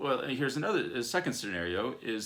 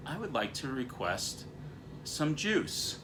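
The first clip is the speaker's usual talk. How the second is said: put-on higher-pitched polite child's request; 5 semitones higher, slower than usual. Child asks mom or dad for juice, politely. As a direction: lightly voice a courteous little kid. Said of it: "having your child walk in and say".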